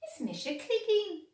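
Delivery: high cat voice. A narrator's reading. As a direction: introduce yourself as a perky cat. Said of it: "ar son cat".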